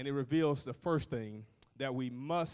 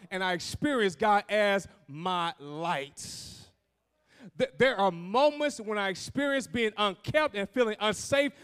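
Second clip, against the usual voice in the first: loud, raised, driving testimony; full-force preaching; 8 semitones higher, 8 dB louder than usual. Acted. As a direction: build to a ringing pulpit declaration.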